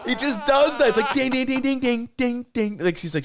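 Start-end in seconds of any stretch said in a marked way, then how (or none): 1.1-2.8 high dings. Retold or imitated. Repeating the ding impression.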